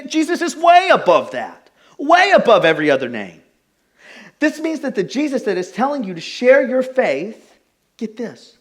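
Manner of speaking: preacher peaking toward the congregation; full-force preaching. Real, not acted. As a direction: build to a shouted proclamation.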